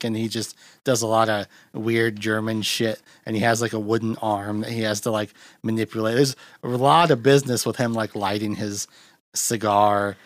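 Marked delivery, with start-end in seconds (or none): none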